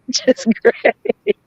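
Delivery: slow, high words squeezed through laughter; laughing. Real, not acted. Speaker delights that the record wasn't pulled up, laughing. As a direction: crack up while saying it. Laughs while repeating own remark.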